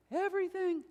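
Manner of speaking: everything lifted high again; "everything" high, held and falling; emphatic repetition. Acted. Pastor repeats word in varied pitch.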